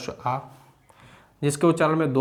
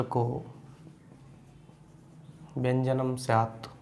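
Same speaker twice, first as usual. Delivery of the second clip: sutra recitation. Shown as a demonstration. Recites Sanskrit sutra slowly. (धीमे स्वर में सूत्र पाठ)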